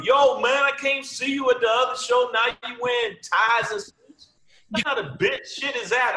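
loud, high imitated heckler; heckler calling out. Fan scolding the comic for switching acts, loud. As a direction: call out the comic for his suit and tie. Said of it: "they would flat out tell you".

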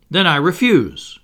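defiant cry. A narrator's reading. Boy standing up to the pirate captain, crying out. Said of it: "he cried".

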